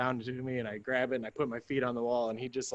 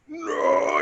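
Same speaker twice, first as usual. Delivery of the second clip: horrified shout; 9 semitones lower, 5 dB louder than usual. Retold or imitated. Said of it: "he's like".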